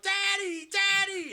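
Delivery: high, loud mock child's cry; hammed-up panicking little boy; Daniel. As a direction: wail for dad in a squeaky falsetto. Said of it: "Daniel's sitting there going".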